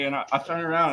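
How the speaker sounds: normal talking voice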